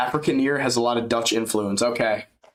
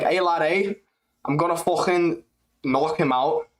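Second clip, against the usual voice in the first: put-on higher voice, Liverpool Scouse accent; 5 semitones higher than usual. Streamer showing off his Scouse accent impression. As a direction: boast like a Scouse scrapper. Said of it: "I'm good with the Scouse impressions".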